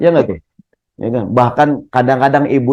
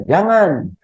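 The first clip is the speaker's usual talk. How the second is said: mock shout; 6 semitones higher than usual. Shown as a demonstration. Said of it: "ibu teriak aja".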